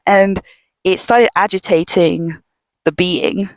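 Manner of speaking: ordinary talk, nothing marked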